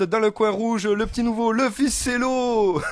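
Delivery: big booming fight-night intro; boxing ring announcer. Acted. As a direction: call out each corner like a ring announcer. Host introduces guests like boxers.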